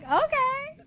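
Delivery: high little reenacted reply; high-pitched retold okay. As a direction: squeak out a surprised okay. Retelling her reaction to invitation.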